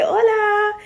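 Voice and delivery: eager hello to the man outside his shop; bright hola. Retold or imitated. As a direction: pop the hola up high and cheerful. Greeting the coffee shop man.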